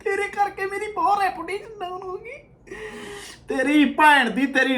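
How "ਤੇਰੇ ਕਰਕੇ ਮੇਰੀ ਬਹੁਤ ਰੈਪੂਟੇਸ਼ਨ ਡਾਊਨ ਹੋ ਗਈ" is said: high, whimpering imitation; mock crying. Reactor mimics the man blaming Canadian Jatt, mock crying. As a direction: parody a tearful complaint in a high voice. Mimics the man's tearful complaint.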